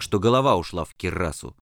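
ordinary delivery

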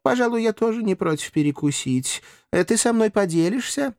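high, affected aristocrat voice; lightly playful high-pitched request; offhand master. A narrator's reading. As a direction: muse casually about having a bite. Master muses about eating.

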